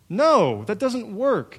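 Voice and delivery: usual speaking voice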